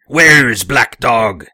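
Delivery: loud, slow captain's demand; slow, forceful old captain voice; crying out. Acted. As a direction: cry it out, drawing out the words.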